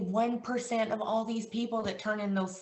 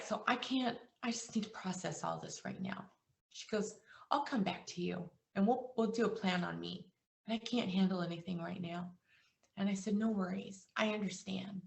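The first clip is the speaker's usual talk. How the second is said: much softer, fading almost to nothing; emotional hush; 7 dB quieter than usual. Real, not acted. Agent reliving the grieving wife's call, hushed and moved. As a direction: speak it as if holding back tears. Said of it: "it makes me emotional talking about it".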